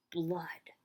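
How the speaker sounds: whispered horror reveal; hushed dread; eerie whisper. A narrator's reading. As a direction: let the word die into a whisper. Floor covered with blood reveal.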